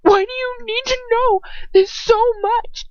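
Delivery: high, loud, cracking voice; loud, high, breaking plea. Acted. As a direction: let your voice crack with fear. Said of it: "my voice is cracking uncontrollably".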